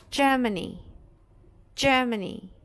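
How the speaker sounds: JER-ma-nee, g as dj; Germany in clear English; English model word. Shown as a demonstration. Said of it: "palavras que são escritas com G".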